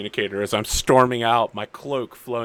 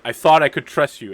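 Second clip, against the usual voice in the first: loud, raised dramatic reproach; hurt accusation; 5 semitones higher, 10 dB louder than usual. Acted. Storming out on Everyman, loud betrayed reproach. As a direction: throw the betrayal at them theatrically.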